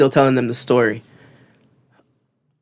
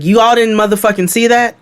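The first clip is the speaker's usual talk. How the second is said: high, indignant retold challenge; indignant mock outburst; hot, retold anger; 8 semitones higher, 3 dB louder than usual. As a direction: re-enact being heated and incredulous. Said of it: "I was hot in that moment".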